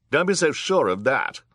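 high, loud retort; defiant judge. A narrator's reading. Judge challenging the villain Olaf, high loud defiance.

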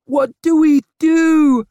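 high, drawn-out panicked scream. A narrator's reading. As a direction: scream it in desperation at your husband. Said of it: "her ma screamed".

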